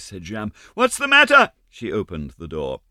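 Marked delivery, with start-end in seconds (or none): 0.7-1.5 loud high alarmed question. Acted. Jeanne asks her mother what happened, alarmed. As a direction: ask in sudden fright.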